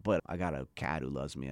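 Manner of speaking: ordinary talk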